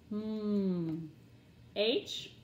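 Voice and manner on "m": M as a held hum, "mmm"; letter sound. Shown as a demonstration. Teacher drilling letter sounds, M as "mmm". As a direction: hum M with closed lips. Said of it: "M, mittens".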